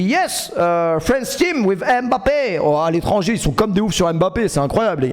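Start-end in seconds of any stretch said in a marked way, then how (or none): 0.0-2.6 slow broken English. Retold or imitated. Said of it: "Je dis".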